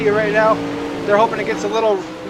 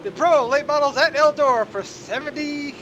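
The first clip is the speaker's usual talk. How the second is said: ringmaster; 6 semitones higher than usual. Pretend. Circus-style race intro.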